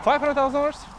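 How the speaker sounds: high, loud, incredulous price check; can't believe the price; amazed. Real, not acted. Stunned at house prices.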